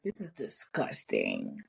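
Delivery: very low, drawn-out disgust; exaggerated disgust. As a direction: sink deep and drag out the revulsion. Retold disgust, heightened.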